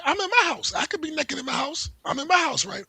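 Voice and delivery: high-pitched imitation of a naked man insisting; squeaky mocking of the guy; mock defiant yell. Guy defying cops in his house, mock high yell.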